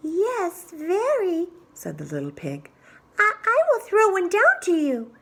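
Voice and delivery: high, nervous piggy voice; little pig. Acted. Little pig up the tree answering the wolf, high and nervous. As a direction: squeak it out nervously.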